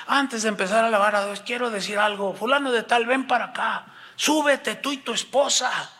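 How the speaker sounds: high-pitched imitation of a commanding pastor; stern public summons. Pastor calling fulano and wife before worship, commanding. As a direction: summon them up before the whole congregation. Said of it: "Así con ese tono".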